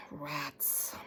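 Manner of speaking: sighed-out, breathy disappointment; dejected sigh. Acted. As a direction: let it deflate with a sigh. Sighs after being kept back.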